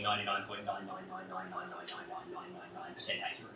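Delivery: quick, low run of nines, thinning out at the end; rapid-fire recited digits. Shown as a demonstration. Preacher correcting his own statement to the congregation, rapid-fire. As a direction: rattle off the repeated nines fast.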